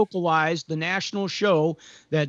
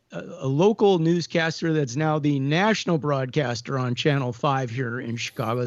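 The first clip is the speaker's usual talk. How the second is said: ordinary delivery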